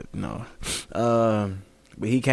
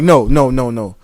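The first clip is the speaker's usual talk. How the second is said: put-on loud offended old vet; indignant outburst; 6 semitones higher, 7 dB louder than usual. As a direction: rant at the cashier, louder.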